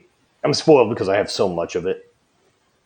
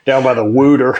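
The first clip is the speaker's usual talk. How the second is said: mock Philly accent, louder; 2 semitones higher, 7 dB louder than usual. Joking about Philly viewers, in a mock Philly accent. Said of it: "Fucking ridiculous accent".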